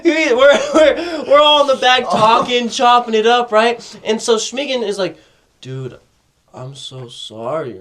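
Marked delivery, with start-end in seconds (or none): 5.6-7.8 low, soft, slow imitation of an apology; mock apologetic. Tripping friend apologizes to the group, mock apologetic. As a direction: draw out a subdued, sheepish apology.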